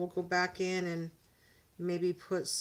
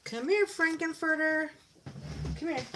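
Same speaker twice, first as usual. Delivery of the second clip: high, playful dog call; calling a pet; 8 semitones higher than usual. Real, not acted. Owner calls stubborn little dog over, high pet voice.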